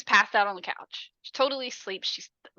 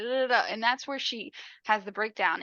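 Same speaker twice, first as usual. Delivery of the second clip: usual speaking voice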